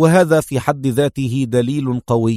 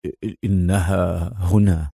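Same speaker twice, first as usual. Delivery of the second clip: low, slow man's voice; frowning concern; 7 semitones lower, slower than usual. A narrator's reading. Frowning man warns she is here, low and grave. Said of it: "عقد حاجبيه قائلًا".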